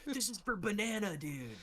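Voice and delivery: hammed-up revenge declaration; mock avenger's cry. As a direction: deliver it like a silly avenger. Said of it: "you just say, like, some random shit".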